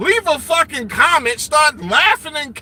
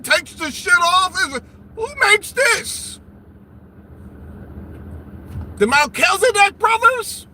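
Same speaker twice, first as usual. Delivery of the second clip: mock outrage; 6 semitones higher than usual. Host yelling at a guest who insults others, hammed-up. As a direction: play the scandalised party host.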